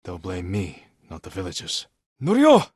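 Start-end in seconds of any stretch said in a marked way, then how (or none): 2.2-2.7 full-voiced shout, much higher and louder; calling out. Samurai yelling for Norio across the battle, loud call. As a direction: call his name across the village.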